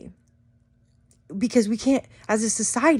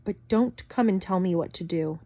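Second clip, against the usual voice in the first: ordinary delivery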